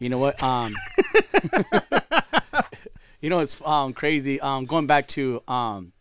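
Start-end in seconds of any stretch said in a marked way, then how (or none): 0.8-2.6 high-pitched real laugh; burst of laughter. Real, not acted. Podcaster reacting to the Home Alone joke, laughing. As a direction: crack up at the joke.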